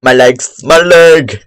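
hammed-up pained shout; mock pained yell. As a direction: cry out as if your leg broke. Game character falls.

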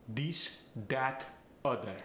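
this, that, other said as dis, dat, udder; Farsi accent. Shown as a demonstration. Coach showing a Farsi accent, this and that said dis and dat. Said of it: "A voiced T H gets the D sound".

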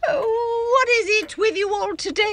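high, loud, fast wail; shrill wail of wounded pride; hysterical outburst. Acted. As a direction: break into a frantic cry. Acted quarrel, hysterical outburst.

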